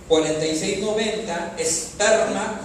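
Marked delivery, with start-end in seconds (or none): 1.6-2.6 "esperma" with the middle E stressed and stretched. Shown as a demonstration. Pastor teaching the Greek word "esperma", stressing its middle E. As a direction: slowly lean on the middle E of "esperma", louder. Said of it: "con acento en la E".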